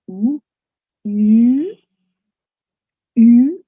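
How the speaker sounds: "U" as a long French vowel. Shown as a demonstration. Teacher showing gentle progress from E, French U. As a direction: glide "U" from ee towards oo. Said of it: "It's a very gentle progress from E".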